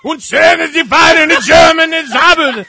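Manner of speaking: fake German gibberish. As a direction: rant in made-up German like a cartoon dictator. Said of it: "that's exactly what it would sound like".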